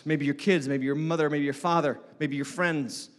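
ordinary talk

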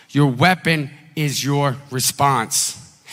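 "Your weapon is your response" repeated slowly, each word weighed; slow it right down so they can write it. Shown as a demonstration. Pastor repeats the sermon point slowly for note-taking. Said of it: "Write this down".

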